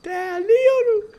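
high, long-drawn mock echo; slow, high-pitched parroting. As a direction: stretch it out high, imitating a friend. Drawn-out repeat of friend's word.

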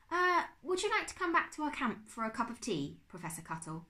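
high, hesitant, courteous offer; polite. A narrator's reading. Bertolt invites the professor to camp, polite. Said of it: "Bertolt asked politely".

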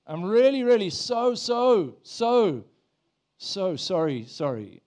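high, loud mock begging, falling away at the end; hammed-up desperate pleading that deflates on the last sorry; over-the-top groveling. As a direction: pile up the apology, then let it sink. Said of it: "I thought pleading means groveling".

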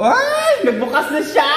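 loud high yelp into laughter; startled delight. Real, not acted. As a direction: yelp as it suddenly comes on. Phone suddenly switches on. (gulat at tuwang sigaw)

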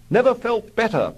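high, loud, strained mock cheer; Algy pretending he's fine, strained and high; queasy bravado. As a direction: force out the cheer while about to be sick. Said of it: "puked Algy".

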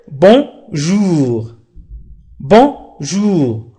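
very slow, word-by-word French modelling; slow beginner repetition. Shown as a demonstration. Teacher repeats the dialogue for beginners, slowly and clearly. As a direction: say each phrase slowly with pauses for learners. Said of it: "سأعيد بالنسبة للمبتدئين".

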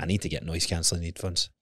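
low, soft grumbling to yourself; quiet grumble. Retold or imitated. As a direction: mutter it like a tired complaint. Retold thought about headphones.

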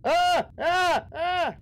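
sharp high screams of fright; startled screams. Real, not acted. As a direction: scream in sudden terror. Startled by jump scare.

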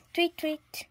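high chirpy tweet, tweet; bird chirp. A narrator's reading. A little bird flying past the tugging family.

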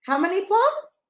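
usual speaking voice

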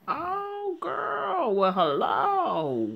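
high, slow, sly greeting; flirty drawl. Acted. Boss of the trailer park greeting Joanne, sly slow flirt. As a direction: leer at her as you stretch it out.

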